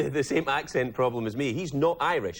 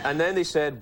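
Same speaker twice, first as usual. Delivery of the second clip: normal talking voice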